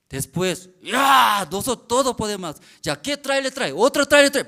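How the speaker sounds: high, loud, fast boasting; triumphant. Retold or imitated. Worker boasts loudly that they can do anything. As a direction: crow about what you can do.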